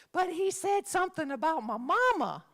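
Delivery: put-on high, whiny kid protest; mock a kid's indignant whine; aggrieved student, hammed up. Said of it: "this is the one that always killed me".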